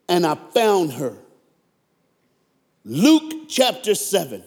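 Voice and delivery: high-pitched, fired-up preaching; not calm. Real, not acted. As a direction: preach it worked up, phrase by phrase with pauses. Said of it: "I can't do this next one calm".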